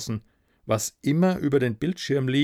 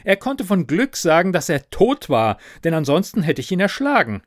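raised, irritated telling; exasperated narration; 6 semitones higher, 5 dB louder than usual. A narrator's reading. Narrator fed up with the dead manager, exasperated. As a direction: tell it with rising annoyance. Narrator losing patience with ghost.

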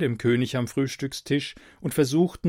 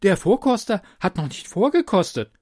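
cautious royal adviser; 7 semitones higher, 5 dB louder than usual. A narrator's reading. Adviser voiced by narrator.

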